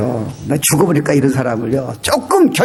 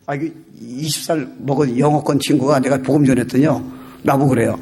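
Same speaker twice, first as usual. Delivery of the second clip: ordinary talk, nothing marked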